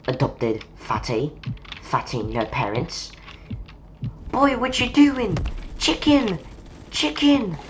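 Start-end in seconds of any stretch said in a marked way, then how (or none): 4.3-7.6 much higher, put-on squeaky chant; silly high voice. Gamer fooling around shouting chicken, silly high voice.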